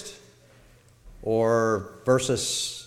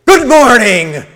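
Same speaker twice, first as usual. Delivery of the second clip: much higher, shouted mock anger; fake anger; 11 semitones higher, 15 dB louder than usual. Shown as a demonstration. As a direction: bark the greeting in staged fury. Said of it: "I was conveying a fake anger".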